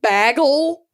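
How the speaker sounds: "bagel" said as "baggle"; first vowel of "bagel" as in "bag"; dialect bagel. Shown as a demonstration. Host listing dialect vowels, "bagel" as "baggle". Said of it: "people in Buffalo say "bagel".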